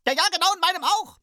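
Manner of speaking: very high, loud, fast dwarf; excited dwarf. Acted. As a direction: chime in eagerly as a tiny dwarf. Dwarves chiming in excitedly.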